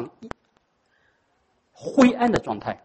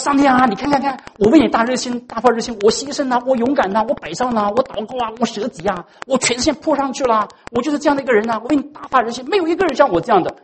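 lightly voiced, higher self-pitying plea; Elijah complaining; 7 semitones higher than usual. Elijah complains to God about his zeal, lightly voiced whining plea. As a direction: pour out the grievance like a sulking child. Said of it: "他说".

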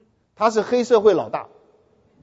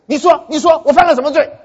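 higher, louder challenging demand; fiercer demand; 6 semitones higher, 6 dB louder than usual. Retold or imitated. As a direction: demand an answer, pushing harder. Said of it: "他更凶的下面就说".

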